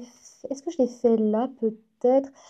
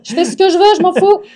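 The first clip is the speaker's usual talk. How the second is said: louder and higher, mock defiance; playful defiant shout; 8 semitones higher, 10 dB louder than usual. Retorting to chat about her red hair, a loud mock-defiant shout. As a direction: fling it back at the chat, half joking.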